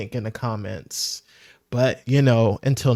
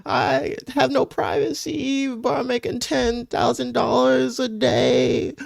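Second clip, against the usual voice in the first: high-pitched, drawn-out fake crying; hammed-up crybaby whimper, pitched way up; mock boohoo whining; 12 semitones higher, slower than usual. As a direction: wail it in a slow, high mock sob. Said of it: "They're, like, boohoo whining and gr-, crying".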